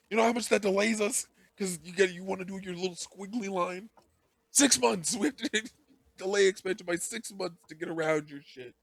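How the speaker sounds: high-pitched, hammed-up indignant rant; mock exasperated complaint. Chief to the digger about delays, in mock exasperation. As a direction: argue in comic outrage, voice pushed high.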